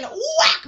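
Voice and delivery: high, loud whack; vocal machete-strike effect; imitated blow. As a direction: punch out the hit.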